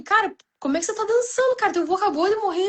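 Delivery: high, fast incredulous scolding; imitating people judging her for dancing; mock indignant busybody. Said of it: "e as pessoas, tipo assim".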